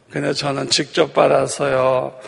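usual speaking voice

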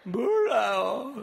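put-on high, loud, very slow sobbing child's voice; crying lost child. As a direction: wail it through tears like a little kid. (울먹이는 아이 목소리로 길게)

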